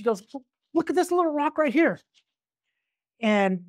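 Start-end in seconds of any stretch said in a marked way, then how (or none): 0.7-2.0 imitated woman. Female voiced in drug deal story.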